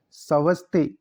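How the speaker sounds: normal talking voice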